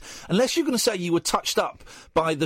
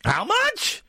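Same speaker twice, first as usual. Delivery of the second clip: high, fast disbelief; can't believe the price; host stunned by the shopping bill; incredulous; 13 semitones higher than usual. Real, not acted. Caller reveals 365 pound shop.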